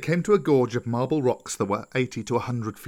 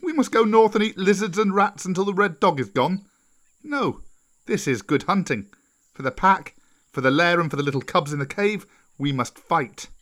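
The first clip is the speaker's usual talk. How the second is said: put-on higher, scornful young hero, then rallying; defiant Mowgli; 6 semitones higher, 3 dB louder than usual. Mowgli urges the wolf pack to fight, higher and defiant. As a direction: rouse the pack with mock disbelief, then resolve.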